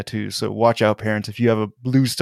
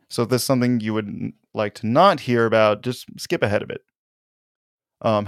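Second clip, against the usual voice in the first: ordinary delivery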